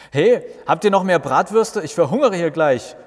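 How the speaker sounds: loud, high call across the yard. Acted. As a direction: shout over to the grill. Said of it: "ruft Martin den Grillmeistern zu". (laut rufend zum Grill hinüber)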